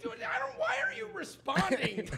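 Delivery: high-pitched baffled protest; retold exasperation with a laugh in it; exasperated dad. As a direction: demand an explanation, voice jumping up. Exasperated dad challenging son's replies.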